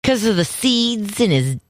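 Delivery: high, drawn-out comic voice; silly ailment voice. Pretend. Wife explaining Monty's stomach trouble, in a silly voice. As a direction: pitch it up and stretch out the medical word. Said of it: "I have to say it in that voice".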